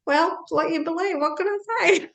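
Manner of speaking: high, giggly shrug of a quote; laughing. Retold or imitated. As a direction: laugh it off lightly. Retelling her reply, still laughing.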